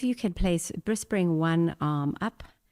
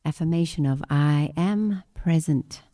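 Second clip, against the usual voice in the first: guided meditation; 3 dB louder than usual. Acted. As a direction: lead the affirmation calmly.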